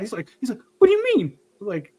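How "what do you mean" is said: high, louder indignant yelp; mock mad dad. Son mimics his angry dad challenging him, high mock indignation. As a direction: imitate an offended father's outburst. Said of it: "he really was mad".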